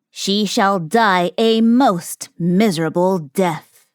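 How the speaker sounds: lightly voiced dwarf, slow and ominous; dwarf's curse, slow. A narrator's reading. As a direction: lay the curse on her slowly.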